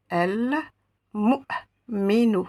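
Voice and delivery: "el mu'minu" read very slowly, syllable by syllable. Shown as a demonstration. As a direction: spell it out slowly, each syllable apart. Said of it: "Je vais donc lire".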